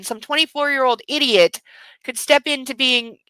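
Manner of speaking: ordinary talk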